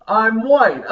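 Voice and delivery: loud, high mock announcement.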